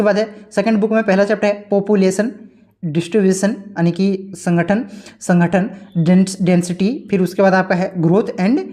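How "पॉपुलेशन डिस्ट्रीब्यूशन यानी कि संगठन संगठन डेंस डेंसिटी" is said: slow dictation. Shown as a demonstration. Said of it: "dictating chapter topics".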